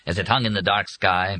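ordinary delivery, nothing marked

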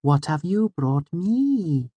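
high, slow, sunny little voice; Auri. A narrator's reading. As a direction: ask it slowly and sweetly, stretching the end. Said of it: "She gave her sunny smile".

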